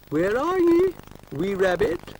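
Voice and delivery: high, loud Scottish call across the garden; loud high-pitched Scottish call. Acted. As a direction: shout for the hidden rabbit in a Scottish voice. Calling out for the rabbit.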